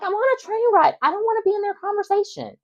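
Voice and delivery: whiny exasperation. Retold or imitated. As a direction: whine that you just want to be left alone. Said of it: "I'm like".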